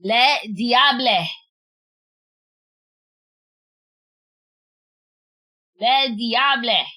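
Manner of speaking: slow Le diable, diable as dee-AHB-luh; careful French. Shown as a demonstration. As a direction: sound out Le diable slowly in French. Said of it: "I'm trying to say it properly".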